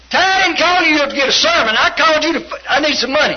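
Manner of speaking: high, loud imitation of angry brother; irate demand. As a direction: act out impatient hollering for cash.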